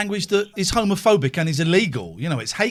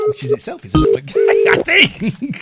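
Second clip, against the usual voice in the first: laughing; 8 semitones higher, 6 dB louder than usual. Real, not acted. Joking about caller's obsession.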